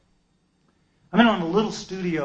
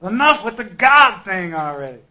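exasperated; 5 semitones higher, 10 dB louder than usual. Retold or imitated. Exasperated, loud.

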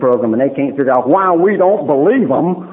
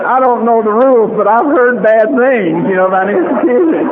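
mock alarm; 6 semitones higher than usual. Comic fear about the institution.

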